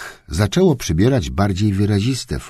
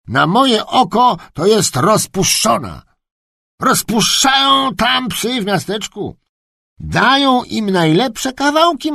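high-pitched, insistent servant's voice; sharp-voiced kennelman; 12 semitones higher than usual. Kennelman explaining the dog to the duke, high and sharp.

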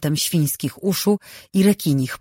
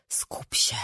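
bezgłośny szept do siebie; szept w myślach; 10 dB quieter than usual. Acted. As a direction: szepnij sobie cicho, skupiając się. Myśl Rin przed egzaminem. (szeptem do samej siebie)

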